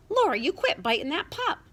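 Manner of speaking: big brother scolding his little sister; alarmed scolding. A narrator's reading. Brother scolds sister biting the pup.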